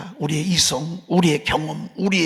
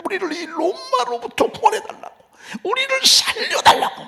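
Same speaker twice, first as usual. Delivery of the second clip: much higher, straining cry for rescue; crowd crying out. Retold or imitated. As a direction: cry out to be saved. (높게 외치듯)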